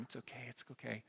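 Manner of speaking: put-on soft, hushed soothing of a scared baby; calm the baby down gently; soothing mom. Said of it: "She starts soothing the baby".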